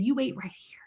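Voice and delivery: hushed whisper to mom in the car; unvoiced whispered order to wait. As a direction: whisper it, keep her in her seat. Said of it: "I said".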